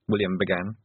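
plain reading voice

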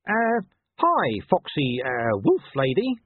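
high, halting attempt at charm; hesitant chatting-up dog. A narrator's reading. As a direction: fumble for a flattering greeting. Dog nervously retries a greeting.

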